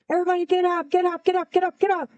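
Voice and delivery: high, urgent mock yell without full volume; retold yell. Airman waking Marines in a smoky tent, retold yell. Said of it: "I yelled to the top of my lungs".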